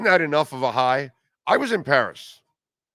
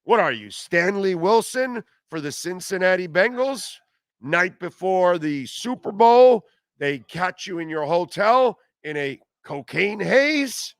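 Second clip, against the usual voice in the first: escalating comedy rant; 6 semitones higher than usual. Real, not acted. Ranting at the athlete.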